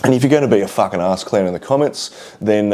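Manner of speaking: ordinary delivery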